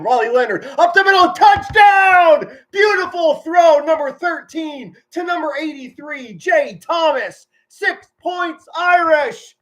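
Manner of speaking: screaming the touchdown, high and loud; full-throated play-by-play scream; excited touchdown call. Real, not acted. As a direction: go wild calling the score like a fan in the stands. Touchdown call while watching game.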